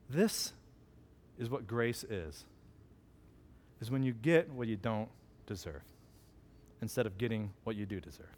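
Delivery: retold fatherly explanation, quiet and low; solemn, tender. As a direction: let each phrase land gently, hushed. Tender punchline to his daughter.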